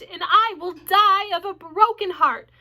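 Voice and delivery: high loud slow anguished plea; pleading with heartbreak; desperate plea. A narrator's reading. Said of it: "said Wilbur in desperation".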